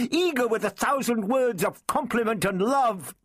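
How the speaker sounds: high, mocking tirade; high-pitched, scornful woman; furious sarcasm. A narrator's reading. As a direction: sneer through the list of his supposed charms. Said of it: "she cried furiously".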